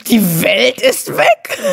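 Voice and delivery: slow, high mocking disbelief; incredulous. A narrator's reading. Lotte mocking the others' fear, incredulous and slow. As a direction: repeat it as if it were absurd. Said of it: "Lotte prustete los".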